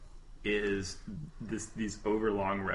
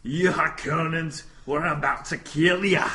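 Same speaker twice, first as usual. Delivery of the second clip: loud, high, hammed-up Scottish brogue; Scottish accent; 7 semitones higher, 8 dB louder than usual. Quoting Gurney's line to friends in a hammed-up Scottish accent. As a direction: belt it out like a Highland warrior in a Scottish accent. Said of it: "I did that accent".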